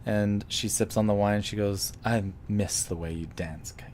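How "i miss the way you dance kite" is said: soft, half-whispered flirtation; hushed, wistful come-on; intimate murmur. Acted. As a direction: murmur it low to an old flame.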